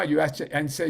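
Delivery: usual speaking voice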